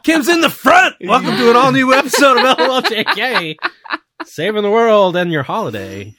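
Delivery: loud, high show-opening announcer voice; big energetic podcast welcome. Acted. Host opens the podcast episode.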